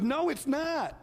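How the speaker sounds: quoting a friend who insists it's bad; imitating a firm no. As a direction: flip the praise back with a high no. Friend contradicts the congratulation.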